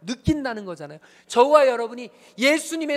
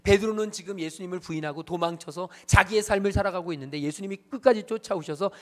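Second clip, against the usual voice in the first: ordinary talk, nothing marked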